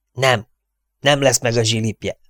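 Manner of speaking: usual speaking voice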